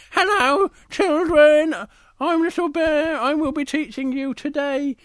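very high, squeaky bear voice; high-pitched, drawn-out little teacher; Little Bear teaching. Acted. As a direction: squeak a greeting to the nursery class.

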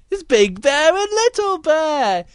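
high, loud, stretched-out announcement; excited reveal. A narrator's reading. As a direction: announce it big for the children. Storyteller reveals the characters.

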